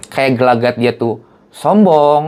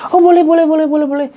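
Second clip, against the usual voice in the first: eager agreeing; faster than usual. Retold or imitated. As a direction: gush out an eager yes. Jupe eagerly agreeing to photo.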